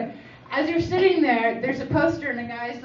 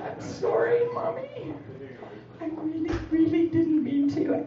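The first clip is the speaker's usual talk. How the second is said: daughter's apology; 4 semitones higher, 7 dB quieter than usual. Retold or imitated. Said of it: "she said".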